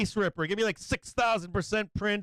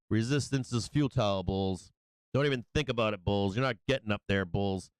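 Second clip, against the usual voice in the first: lower, taunting doom voice dropping on bulls; menacing resistance level; 10 semitones lower than usual. Acted. Trader voicing resistance taunting the bulls, low menace. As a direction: gloat like a villain guarding the line.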